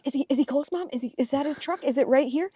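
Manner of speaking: put-on high, eager little girl questions; imitated eager child. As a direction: bounce with impatience like a kid. Imitating daughter eager for dad's arrival.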